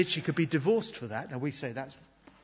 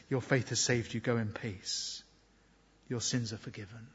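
tender words of Jesus; 5 semitones lower, 7 dB quieter than usual. Acted. Preacher voicing Jesus gently to the forgiven woman, soft and tender. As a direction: speak it as a quiet blessing.

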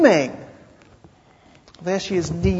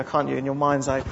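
normal talking voice

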